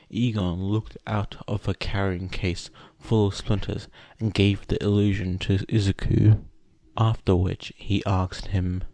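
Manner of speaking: usual speaking voice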